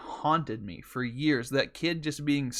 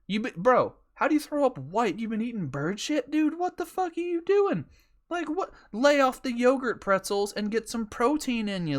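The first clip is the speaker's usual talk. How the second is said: higher-pitched, exasperated disbelief; incredulous ranting; 8 semitones higher than usual. Pretend. Repo man to little Timmy who threw up, incredulous. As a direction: berate him in baffled, rising outrage. Acted incredulous rant at vomiting kid.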